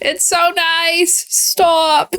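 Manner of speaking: high, drawn-out tearful whine; real choked-up tears. Real, not acted. Moved viewer watching the town rally for George, choked up. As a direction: squeeze it out high and slow, near tears. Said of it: "it's just gonna be a flood of tears".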